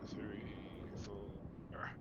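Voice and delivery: fading count. Acted. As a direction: count it out, dropping to a whisper. Counting down quietly.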